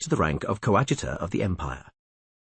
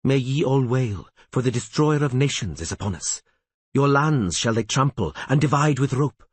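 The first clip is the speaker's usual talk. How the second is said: raised, solemn doom-laden reading; prophetic recitation; 4 semitones higher than usual. A narrator's reading. Reading the prophecy of doom aloud, solemn and raised. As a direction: proclaim the prophecy.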